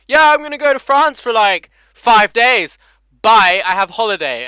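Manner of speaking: high, loud, breezy mock voice of his girlfriend; mimicking girlfriend. Boyfriend mimics girlfriend announcing her France trip, breezy high mock voice. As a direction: toss it off like a carefree holidaymaker. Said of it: "She's just like".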